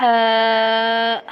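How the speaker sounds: ہا as a thin throaty h, vowel held long; drawn-out thin حا for ہا; thin, from throat. Shown as a demonstration. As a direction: sustain a light pharyngeal "haa" on ہا. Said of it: "ہا کو باریک کر کے پڑھنا ہے".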